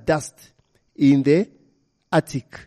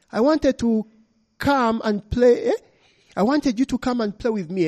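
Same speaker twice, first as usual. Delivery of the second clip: higher, lightly voiced small girl asking to play; a spirit girl begging a visitor to play, lightly childlike; little girl wanting to play; 7 semitones higher than usual. A narrator's reading. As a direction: coax like a lonely child.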